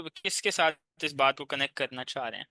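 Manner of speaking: normal talking voice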